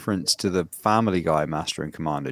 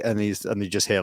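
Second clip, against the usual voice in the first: ordinary talk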